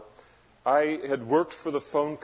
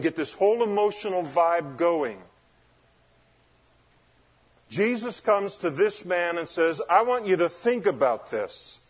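raised, emphatic preacher voice; forceful preaching; 6 semitones higher, 6 dB louder than usual. Acted. Preacher to congregation on God's grace, forceful preaching. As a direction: drive each point home from the pulpit.

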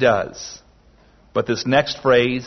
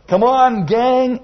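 calling the gang; 10 semitones higher, 3 dB louder, slower than usual. Pretend. Lord voiced calling his friends.

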